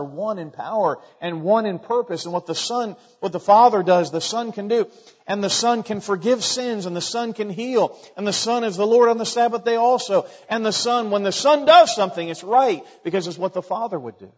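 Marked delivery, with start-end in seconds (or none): 3.2-12.8 rising, emphatic preacher's voice; fervent preaching. Acted. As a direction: build it up for the congregation. Pastor preaching the Son's power.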